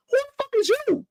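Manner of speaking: squeaky high indignant question; high retold outburst. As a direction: shoot it up high in disbelief.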